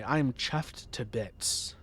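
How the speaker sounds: slow, playful mock British accent. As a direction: savour it like a Brit, slowly. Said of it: "as those across the pond would say".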